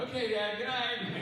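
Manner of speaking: put-on high, loud, cheerful kid sign-off; chirpy little boy. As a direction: bounce up like a satisfied small boy saying good night. Son answering, game ends.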